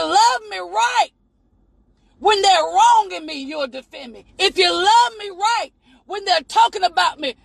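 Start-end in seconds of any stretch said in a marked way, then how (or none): 3.4-4.2 softer, lower closing words; dropped to a hush. Real, not acted. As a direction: let it fall to a near hush. Preacher drops voice for effect.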